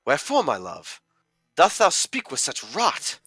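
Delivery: a wounded lover scoffing in verse; theatrical offended protest; Johnny. Acted. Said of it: "So I am Johnny".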